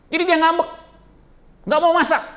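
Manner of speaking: high, loud comic build-up; excited punchline. Acted. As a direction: punch the joke line loudly. Telling sulking wife joke. (bercanda dengan suara tinggi dan keras)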